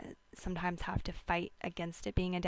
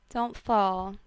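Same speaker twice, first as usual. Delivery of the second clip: loud warning; 8 dB louder than usual. Acted. Vlogger warns viewers off the holiday slope, loudly. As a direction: punch out don't fall as a sharp loud warning.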